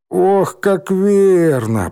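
drawn-out mock groan. A narrator's reading. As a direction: moan it out slowly. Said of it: "простонал Рыжий".

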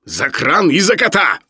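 high, snapped retort; sudden bark. A narrator's reading. Professor tells Sharikov what the fine is for, barking. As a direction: lose the ironic calm and bark. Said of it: "рявкнул вдруг Филипп Филиппович".

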